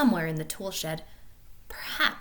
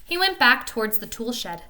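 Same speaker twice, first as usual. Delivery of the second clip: usual speaking voice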